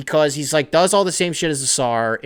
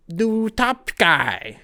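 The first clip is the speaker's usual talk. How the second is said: mock grand title; 6 semitones higher, much slower than usual. Slow hyped title for Shams.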